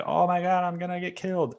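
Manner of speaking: high, frightened gasp of a dreamer; mock panic. Dreamer sure he's about to be killed, mock panic. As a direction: blurt it like you're about to die.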